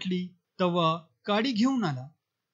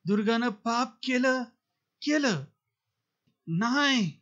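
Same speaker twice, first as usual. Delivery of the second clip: leaping shout; 5 semitones higher than usual. A narrator's reading. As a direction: jump up and yell it back at the elders. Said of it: "जागीच उडत ओरडला". (उसळून उंच आवाजात ओरडणे)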